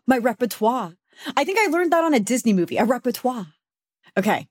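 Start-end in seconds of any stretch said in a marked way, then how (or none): 0.2-0.9 repertoire said REP-uh-twah; French-style repertoire. Shown as a demonstration. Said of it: "I thought it was repertoire". 2.8-3.5 repertoire said REP-uh-twah; French-style repertoire. Shown as a demonstration. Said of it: "I thought it was repertoire".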